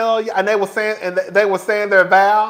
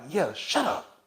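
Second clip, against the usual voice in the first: imitated hushing; 11 dB quieter than usual. Friend hushing the nosy onlooker, imitated under the voice. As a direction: quietly imitate someone shutting down a question.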